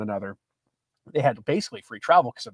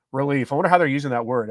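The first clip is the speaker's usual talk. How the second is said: normal talking voice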